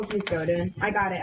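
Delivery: ordinary talk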